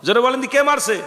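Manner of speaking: usual speaking voice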